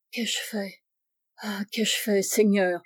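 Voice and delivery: hushed, despairing self-reproach. A narrator's reading. Old man realises rescue came too late, hushed despair. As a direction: let it sink into quiet anguish. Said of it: "avec désespoir".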